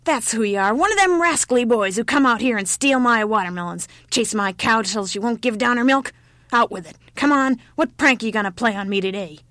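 put-on loud, scolding old-woman voice; cranky, accusing old woman. A narrator's reading. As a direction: rail at a rascally village boy.

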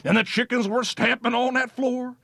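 plain reading voice

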